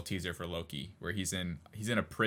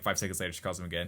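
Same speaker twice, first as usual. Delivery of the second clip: ordinary talk, nothing marked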